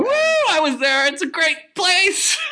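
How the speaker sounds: high, slow, shouted cheer; excited tourist. Pretend. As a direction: hype it like a thrilled tourist.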